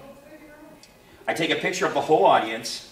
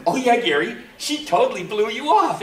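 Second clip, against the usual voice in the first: higher, louder retold ribbing; emphatic teasing; 7 semitones higher, 5 dB louder than usual. [name] confirms to Gary the snub, emphatic teasing. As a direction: rub it in with relish.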